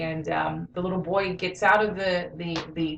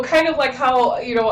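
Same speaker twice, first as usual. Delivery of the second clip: ordinary talk, nothing marked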